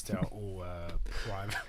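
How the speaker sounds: normal talking voice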